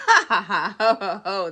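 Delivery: loud ha-ha rolling into ho-ho; hearty acted laugh. Elephant overcome with mirth, loud laughing. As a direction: belt out a big storybook belly laugh.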